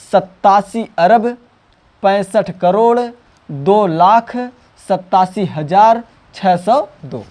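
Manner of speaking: slow, with a pause after each group; slow model reading of the full number; slow spaced reading. Shown as a demonstration. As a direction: read it out chunk by chunk for the class. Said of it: "इस तरीके से आप पढ़ोगे".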